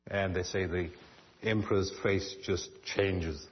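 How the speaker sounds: normal talking voice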